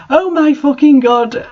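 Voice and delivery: high panicked outcry; cry out. A narrator's reading. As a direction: shriek in horror at the camera. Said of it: "I cried".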